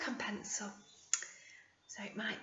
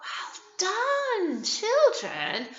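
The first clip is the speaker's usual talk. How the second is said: high praise; 9 semitones higher, much slower than usual. Acted. Praising children.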